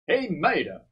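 ordinary delivery

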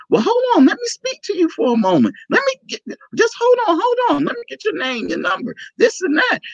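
high, hurried mimicry of an eager man; urgent. Film maker stopping a stranger for his name, urgent. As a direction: act out someone stopping a person in a rush. Retold urgent plea to wait.

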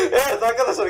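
high-pitched words through laughter; laughing. Real, not acted. Host teased about his intro, laughing. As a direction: start talking while still laughing.

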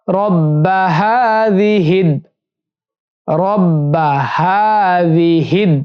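রব্বা হাযিহিদ with the final দ clearly closed; হাযিহিদ, শেষে দ স্পষ্ট; recited with the end joined. Shown as a demonstration. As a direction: model হাযিহিদ ending firmly on দ. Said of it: "হিদ বলতে হবে".

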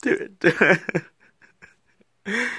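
ordinary talk, nothing marked

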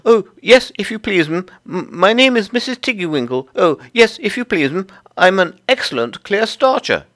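high, loud little creature voice, stammering politely; flustered Tiggywinkle. Acted. Tiggywinkle introduces herself to Lucy, high and fluttery. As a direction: pipe up eagerly as a small humble laundress.

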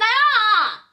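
high, slow sing-song warning. Retold or imitated. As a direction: stop her sister with a sing-song ah ah. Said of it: "I'm like".

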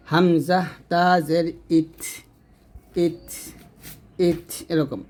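আত, ইত, উত ending in an airy puff on the T; breath released. Shown as a demonstration. Teacher shows students a puff of air ending আত, ইত, উত, breath released. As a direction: let air escape after the final T of আত, ইত, উত. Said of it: "বাতাসটা বের করবেন এরকম".

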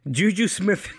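giggling mid-word; laughing. Real, not acted. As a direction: laugh through the name. Laughing through tongue twister.

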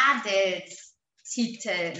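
"Adelstitel" drawn out slowly; slow teacher dictation. Shown as a demonstration. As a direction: stretch the syllables for writing down. Dictating the word while writing.

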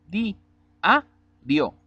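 "di, a, dio" split into separate syllables. Shown as a demonstration. Teacher splitting "di, a, dio" for the class. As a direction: spell out "di, a, dio" one beat at a time. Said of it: "Separando en sílabas".

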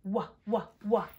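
"Wah" in short separate repeats; letter sound. Shown as a demonstration. Teacher showing W's sound, short repeated "Wah". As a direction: say "Wah" as isolated beats. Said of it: "What sound does W make".

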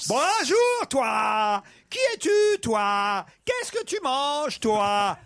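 high, loud, stretched words; frog voice. Pretend. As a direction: call out with a huge mouth. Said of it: "la grenouille fait".

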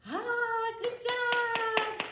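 very high, very loud cheer; excited praise. Real, not acted. Teacher praising kids after the robot song, elated cheer. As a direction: burst out cheering for them.

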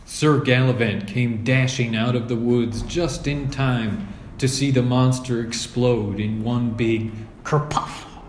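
plain reading voice

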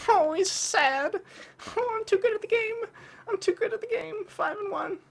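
mock the beaten enemy in a silly high voice; squeaky mocking voice. Gamer mocking his sad opponent in a squeaky voice.

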